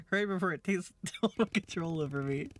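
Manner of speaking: laughing. Real, not acted. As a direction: giggle out the warning. Mask taking control joke.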